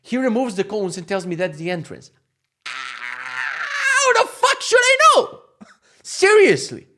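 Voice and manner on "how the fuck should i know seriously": very high, drawn-out furious shout; exasperated scream. Retold or imitated. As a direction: scream it in hammed-up outrage, stretching the words. Ranting about the hidden car park entrance.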